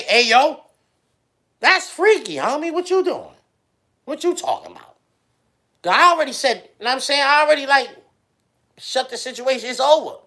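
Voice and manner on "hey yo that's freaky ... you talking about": loud. Acted. Acting out the argument.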